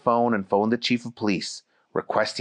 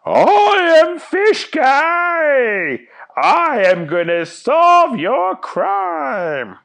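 high, drawn-out heroic yell; proud yelling; 6 dB louder, much slower than usual. Acted. Fish Guy boasts to the cameras, yelling slow and high. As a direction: bellow it slowly like a boastful cartoon hero. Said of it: "He kept yelling".

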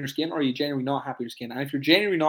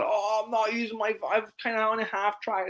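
higher, sheepish admission; sheepish client; 7 semitones higher than usual. Retold or imitated. Coach imitates client admitting the app went unused, higher and sheepish. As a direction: own up like a caught-out client.

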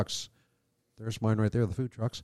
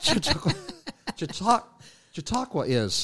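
laughing through the word; 5 semitones higher than usual. Real, not acted. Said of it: "I can't even say it".

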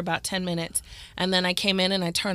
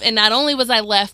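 ordinary talk

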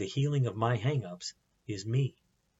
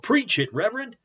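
high, loud cheer for the preacher; hammed-up church-lady holler, pitched way up; imitated yell; 11 semitones higher, 5 dB louder than usual. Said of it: "they yelled".

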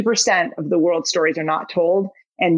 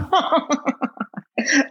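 burst of laughter; 7 semitones higher than usual. Real, not acted. Laughs at the question.

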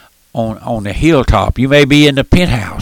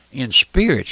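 usual speaking voice

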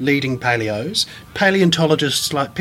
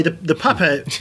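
ordinary talk, nothing marked

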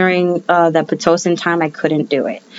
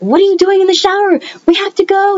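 hammed-up frantic hurry; imitating frantic partner; 11 semitones higher, faster than usual. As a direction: play the husband rushing her out the door in a flap. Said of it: "He's, like, freaking out".

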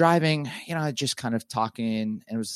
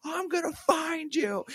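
much higher, furious shout, dragged out; screaming like a wild man. Retold or imitated. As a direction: yell it after the running kids like a madman. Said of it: "screaming things like a wild man".

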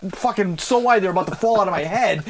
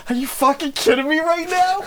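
retold disbelief; 10 semitones higher than usual. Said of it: "my face was just like".

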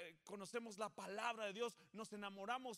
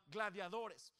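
ordinary delivery

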